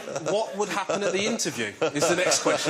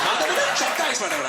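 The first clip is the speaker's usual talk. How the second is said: high, fast mock Sri Lankan chatter; mum's coded gossip; 8 semitones higher, 4 dB louder, faster than usual. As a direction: rattle off gibberish as a Sri Lankan mum. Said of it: "she'll try and code it up".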